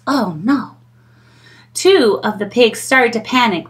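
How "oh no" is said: worried whisper. Acted. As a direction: hush as if scared. Whispered worry.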